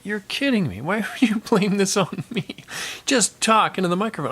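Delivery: high, incredulous complaint; exasperated protest. Retold or imitated. Engineer blamed by the drummer, exasperated protest. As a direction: plead in disbelief, pitch jumping up.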